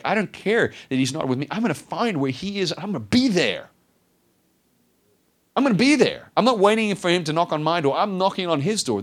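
high, loud, fervent resolve; rising to a near cry of devotion; determined. Retold or imitated. Woman vowing to find Jesus, high and loud. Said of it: "She was like".

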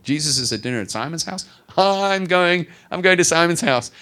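high, loud, thrilled announcement; bursting to go, raising her voice; eager. Retold or imitated. Sinful woman bursting to reach Jesus, thrilled and loud. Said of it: "She's like".

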